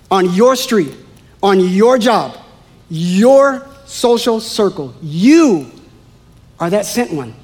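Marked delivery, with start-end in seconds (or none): none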